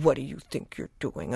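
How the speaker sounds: low, understated telling-off; displeased scolding. Retold or imitated. Owner to dog on a down-stay, low understated scolding. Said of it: "not very happy about it".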